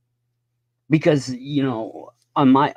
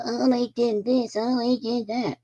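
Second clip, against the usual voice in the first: high, mocking whine, a parody of complaining; whiny mockery; 11 semitones higher, 4 dB quieter than usual. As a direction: whine it like a crybaby mimicking the other side. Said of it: "the right just whines and cries and goes".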